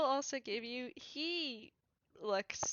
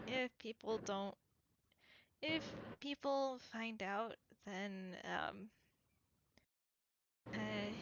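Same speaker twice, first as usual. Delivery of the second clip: lightly voiced weary character, softer and subdued; understated tired confession, trailing softer; exhausted man, guarded; 2 semitones lower, 6 dB quieter than usual. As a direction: confide it quietly, a touch worn out. Plays the exhausted character answering.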